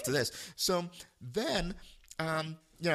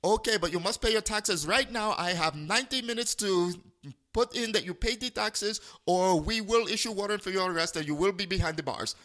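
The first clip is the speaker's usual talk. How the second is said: put-on higher insistent Indian-accented scammer; imitated Indian accent; 6 semitones higher, 3 dB louder than usual. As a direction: press him to pay in a mock Indian accent. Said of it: "duplicate his voice and accent".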